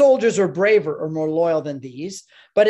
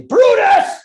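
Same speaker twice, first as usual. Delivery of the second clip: full-throated roar, much higher and louder; bellowing for the executioner; 11 dB louder, slower than usual. Acted. Governor summoning the executioner Brutus, roaring. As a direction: bellow the name across the hall like a Roman governor. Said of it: "And I turn around and I say".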